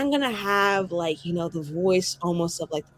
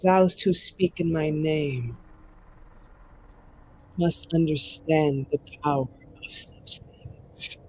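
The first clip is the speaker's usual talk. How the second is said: voice of the Maker; 4 semitones lower, slower than usual. Acted. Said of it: "resounding warmth in the voice of the Maker".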